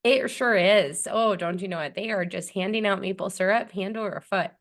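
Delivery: hammed-up northern Canadian accent; mock Canadian accent. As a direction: play along in a folksy Canadian accent. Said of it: "Canadian accent versus, like, some of the Middle Western".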